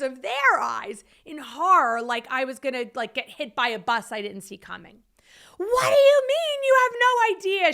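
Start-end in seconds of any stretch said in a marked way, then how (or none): 5.6-7.7 high, loud boss's scream retold; screamed outrage. Boss screaming at her new recruiter, screamed outrage. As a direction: yell it in outraged disbelief, far above your usual pitch. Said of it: "she screams".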